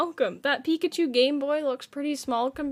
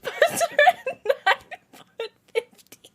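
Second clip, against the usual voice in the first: higher voice dissolving into laughter; real breathless laughter; laughing; 9 semitones higher than usual. Real, not acted. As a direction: lose it at the punchline. Streamer laughing at chat joke.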